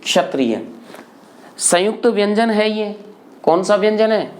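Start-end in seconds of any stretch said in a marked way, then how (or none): 0.0-0.7 lower, falling क्षत्रिय opening on k joined to sh; ksha demo. Shown as a demonstration. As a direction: model क्षत्रिय carefully, a half k into retroflex sh. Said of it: "ये क्या लिखा है?". (क्षत्रिय में क्ष का साफ़ उच्चारण)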